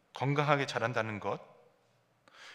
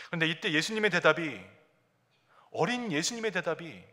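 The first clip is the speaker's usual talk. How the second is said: ordinary delivery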